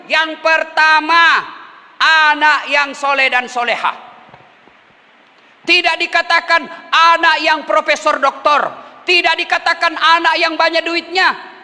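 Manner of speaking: high-pitched, driving sermon delivery; emphatic pulpit oratory; fervent preaching. Real, not acted.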